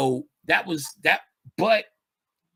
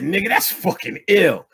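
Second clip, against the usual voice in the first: higher and louder, hyped up; excited reaction; 6 semitones higher, 7 dB louder than usual. Retold or imitated. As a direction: blurt it out impressed.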